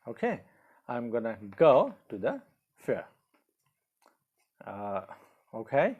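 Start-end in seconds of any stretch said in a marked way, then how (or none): 0.9-3.1 "gonna" merged into one word; contracted form. Shown as a demonstration. Teacher repeats the example for learners, contracted "gonna". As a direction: run "I'm gonna" together, then space out the rest. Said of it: "in contracted form in speaking we use".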